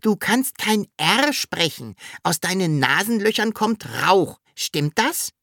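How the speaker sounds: high-pitched little creature voice; Sams working it out. A narrator's reading. Sams decoding the dragon's speech, high pert voice. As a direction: explain and check, pitched well up.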